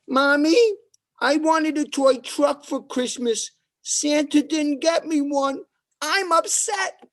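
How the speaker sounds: put-on man-child whining to his mom; whiny kid. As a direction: sulk like a kid cheated at Christmas.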